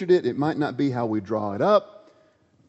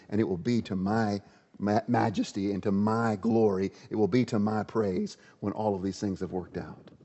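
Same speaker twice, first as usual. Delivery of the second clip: low, soft and steady assurance; much softer, lower reassuring voice; calm God; 4 semitones lower, 4 dB quieter than usual. Acted. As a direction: settle him down gently. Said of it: "He says".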